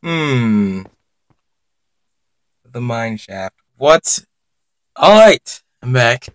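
usual speaking voice

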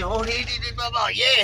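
put-on high, jabbering Tillman's Corner accent; local Tillman's Corner accent. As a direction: imitate a local babbling in Tillman's Corner talk. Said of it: "He said".

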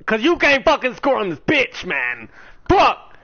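raging gamer. Real, not acted. Lost the game, raging.

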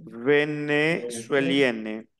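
drawn-out venezuelienne, syllable by syllable; slow model pronunciation. Shown as a demonstration. French teacher models the feminine nationality for a student, slow and measured. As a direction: stretch out venezuelienne slowly for a learner. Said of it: "Como es femenino sería".

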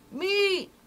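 high, stretched-out hawker's cry; long, high market cry; street vendor's call. A narrator's reading. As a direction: call it out like a meat seller. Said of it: "calling out".

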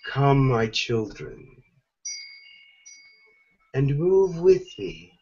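channelled Mother Gaia. Acted. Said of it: "she is going to take over".

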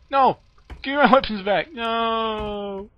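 high whiny protest; high indignant no; upset protest. Real, not acted. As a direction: plead for the guns back. Weapons taken, protesting.

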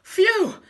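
loud, high "phew!"; big exaggerated relieved exclamation; phew of relief. A narrator's reading. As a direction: blow it out after a fright. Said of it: "said Hugh".

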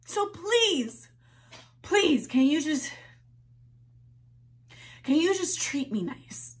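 high, tearful begging; desperate pleading. Acted. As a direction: beg with what's left. Begging mother after outburst.

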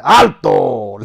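sudden loud halt order; sergeant's command. Retold or imitated. Sergeant surprising the corporal, shouted command. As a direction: shout him to a stop.

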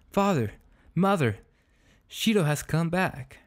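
high, excited calling out; acted shout. A narrator's reading. Boy announcing the dog's return to parents, acted shout. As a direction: call out the news to the parents, moderately loud. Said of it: "shouted in a loud voice".

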